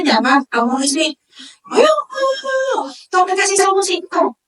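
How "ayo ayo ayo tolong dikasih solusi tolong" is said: much higher, near-tearful pleading wife; wife begging her husband for a solution, high and tearful. Acted. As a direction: beg him for an answer like a tearful wife.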